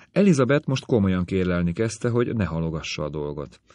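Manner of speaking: usual speaking voice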